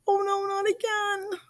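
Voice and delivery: high, quiet groan of "not again"; dismayed inner voice. A narrator's reading. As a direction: sigh it out, defeated. Lunch dropped on loafers.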